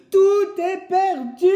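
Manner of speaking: high, loud, slow despairing wail; woodcutter crying out. Acted. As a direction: wail in defeat under the stings. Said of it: "crie le bûcheron". (cri désespéré, aigu et fort)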